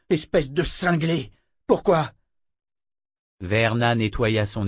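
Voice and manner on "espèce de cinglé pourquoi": slow, biting insult, then a higher demanding why; outraged young man; angry accusation. Acted. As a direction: spit the insult slowly, then demand an answer. Richard accuses Verna of murder.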